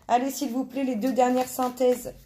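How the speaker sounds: normal talking voice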